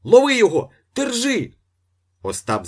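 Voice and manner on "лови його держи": very high, loud yell; chase shout. A narrator's reading. Ostap raising a chase after the priest, shouting. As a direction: holler after someone running away.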